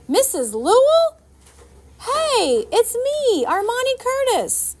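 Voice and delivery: loud, very high call. A narrator's reading. Girl calling up to her neighbour on the roof. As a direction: call up to her across the water.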